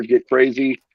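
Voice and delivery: ordinary talk, nothing marked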